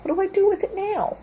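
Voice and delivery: high, quick, lightly voiced puzzled dog. Dog looks up at owner holding squirrel, high puzzled voice. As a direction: voice the dog asking what now. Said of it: "looks up at you like".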